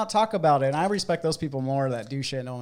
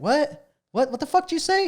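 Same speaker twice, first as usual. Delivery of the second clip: incredulous; 12 semitones higher than usual. Retold or imitated. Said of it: "And he's like".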